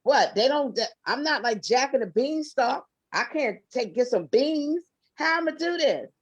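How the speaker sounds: incredulous retort; half-laughing, flustered pushback; retelling her reply to God. Retold or imitated. To God, a mock flustered protest about money. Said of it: "And I said".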